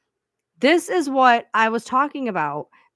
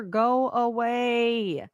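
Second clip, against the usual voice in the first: slow drawn-out go away; 3 semitones higher, 4 dB quieter, much slower than usual. Real, not acted. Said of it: "telling the commenter to leave".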